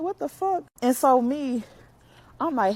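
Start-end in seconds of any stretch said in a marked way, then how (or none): 0.0-0.6 surprised. Retold or imitated. Hearing things thrown.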